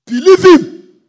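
sudden very loud high command; shouted exhortation. Real, not acted. Preacher urging the congregation, very loud shout. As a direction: blast it at the crowd.

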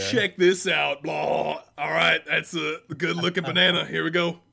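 put-on loud, slow cocky teen showing off; mock-proud teen about to perform; hammed-up show-off. Acting out banana show-off.

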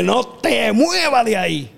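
high, drawn-out mock command; retold shouted order. Promoter ordering a fallen wrestler to stay put, retold shout. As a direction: imitate calling out a forceful order.

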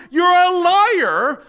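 slow, high, loud rebuke; rebuking shout. Acted. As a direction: call out the devil slowly and hard. Rebuking the devil.

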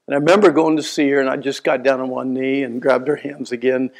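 normal talking voice

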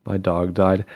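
mock sad. Shown as a demonstration. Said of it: "I could be like".

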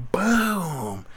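high, punchy "Boom!"; punch sound effect. Retold or imitated. Acting out knocking the fool out, punch noise. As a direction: pop the word out like a hit.

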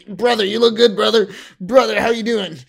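loud, high-pitched brother greeting; Grey Crow. Retold or imitated. Grey Crow greeting Wild Child, loud put-on voice. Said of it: "he's doing that thing".

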